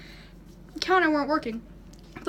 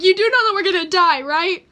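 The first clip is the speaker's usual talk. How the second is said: louder, fast, frantic; frantic to the dog; 4 semitones higher, 7 dB louder than usual. Retold or imitated. Teen panicking at her calm dog, frantic. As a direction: rush it in a panic.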